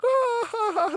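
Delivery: very high, squeaky mock giggle; hammed-up high-pitched laughter; mock girls' laugh. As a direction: mimic the girls cackling at him. Mocking the girls laughing at him.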